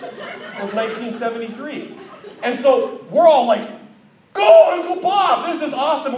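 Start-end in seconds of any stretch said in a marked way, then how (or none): 4.3-6.2 high, loud whoop of a crowd; cheering. Acted. Guests egging on uncle on the dance floor, excited cheer. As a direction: cheer him on, drawing out the first word.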